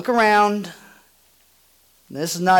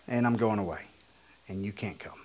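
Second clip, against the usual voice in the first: lower, much softer, heavy pause; hushed, solemn; 8 semitones lower, 11 dB quieter, faster than usual. Acted. Pastor voicing Jesus leaving his friends, hushed. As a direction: let it fall to a grave hush. After excited list, drops.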